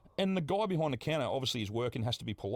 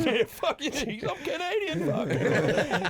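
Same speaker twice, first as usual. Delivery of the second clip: high, loud put-on voice, laughing; hammed-up obnoxious tourist; cheeky mock Canadian snarl; mock rude Canadian; 14 semitones higher, 5 dB louder, much slower than usual.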